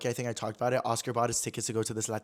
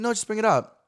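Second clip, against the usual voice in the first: high, loud brush-off of worry; imitated urging; 9 semitones higher, 5 dB louder than usual. Friend urging him to speak up, imitated insistence. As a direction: push the friend to just do it.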